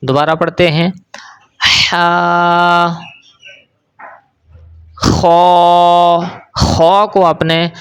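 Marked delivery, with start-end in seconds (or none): 1.9-2.9 ہا held long on a steady note, h from mid-throat; drawn-out Arabic ہا, squeezed in the throat. Shown as a demonstration. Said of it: "حلق کے درمیان سے ادا کرنا". 5.0-6.2 خو held long with a scraped upper-throat kh; heavy, rounded, drawn-out خو; thick, heavy letter. Shown as a demonstration. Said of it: "موٹی آواز کے ساتھ پڑھنا ہے".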